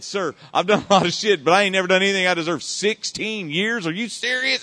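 loud, high disbelief; retold indignant protest. Defendant protests his sentence to the judge, loud and incredulous. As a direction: plead your case in outraged astonishment.

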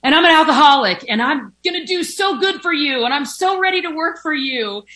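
hammed-up eager job seeker; overeager pleading. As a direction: beg for the job with too much enthusiasm. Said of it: "just being like".

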